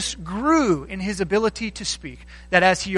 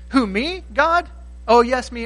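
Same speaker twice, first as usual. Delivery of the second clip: "Who, me, God?" with rising pitch, shocked; incredulous Moses; 4 semitones higher than usual. Acted. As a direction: pitch jumping up in disbelief. Moses voiced in disbelief.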